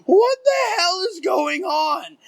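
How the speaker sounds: high, loud, drawn-out cry of disbelief; panicked yell. Retold or imitated. Storyteller reliving fireworks aimed at his friend, panicked drawn-out yell. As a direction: wail it slowly at the top of the voice.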